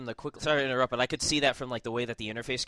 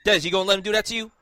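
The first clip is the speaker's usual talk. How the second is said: louder, higher playful egging on; teasing call-out; 5 semitones higher, 4 dB louder than usual. Real, not acted. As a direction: stir up trouble across the room.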